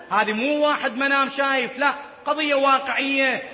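ordinary talk, nothing marked

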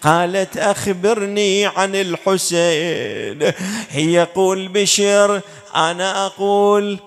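slow, intoned tragic narration; mourning recitation. A narrator's reading. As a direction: stretch the line endings like an elegy. Intones dialogue of tragedy. (إلقاء رثائي منغّم)

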